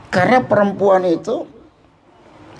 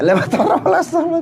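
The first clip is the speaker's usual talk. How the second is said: higher, comic mock wife talking back; wife's retort; 9 semitones higher, much faster than usual. Wife retorting to her husband, comic higher voice. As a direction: snap back like an offended wife.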